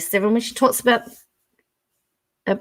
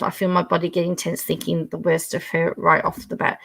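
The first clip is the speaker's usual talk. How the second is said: ordinary delivery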